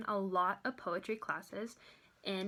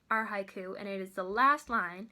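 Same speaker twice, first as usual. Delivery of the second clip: normal talking voice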